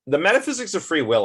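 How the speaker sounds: excited heading, rushed; eager announcing. Real, not acted. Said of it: "I don't think I talked about that".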